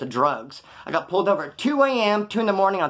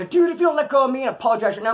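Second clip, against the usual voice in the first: angry threat; 7 semitones higher, 4 dB louder than usual. Retold or imitated. Retold threat while held from behind.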